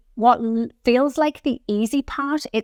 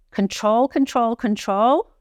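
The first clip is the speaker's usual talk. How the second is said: slow coaching cue; slower than usual. Shown as a demonstration. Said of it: "coaching the slow lowering".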